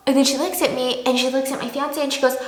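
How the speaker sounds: ordinary talk, nothing marked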